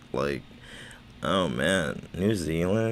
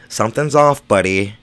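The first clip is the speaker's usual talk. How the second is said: louder insistent repeat; 8 dB louder than usual. Shown as a demonstration. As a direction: lean on "off" the second time, louder.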